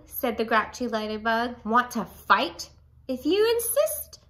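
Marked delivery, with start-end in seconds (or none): 1.6-2.7 grouchy challenge. A narrator's reading. Grouchy ladybug challenges yellow jacket. 3.1-4.2 yellow jacket voice. A narrator's reading. Yellow jacket accepts fight.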